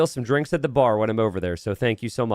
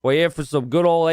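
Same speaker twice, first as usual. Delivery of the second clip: a light mock Australian accent, lightly done; 3 semitones higher, 3 dB louder than usual. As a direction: play at an Aussie greeting. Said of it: "How's my accent?".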